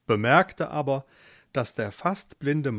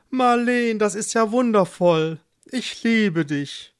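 high, loud delight easing into tenderness; enthusiastic husband; 7 semitones higher, 4 dB louder than usual. Acted. Eduard hears he'll be a father, thrilled. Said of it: "Auch ihr Mann war begeistert".

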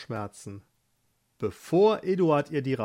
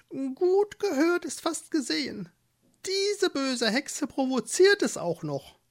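much higher quirky mole voice; high, squeaky mole saying his motto; wise mole; 12 semitones higher than usual. Acted. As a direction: deliver it like a little know-it-all mole. The mole comments.

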